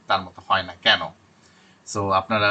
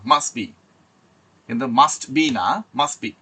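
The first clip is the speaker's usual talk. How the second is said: ordinary talk, nothing marked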